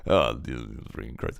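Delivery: ordinary delivery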